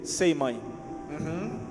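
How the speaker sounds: ordinary talk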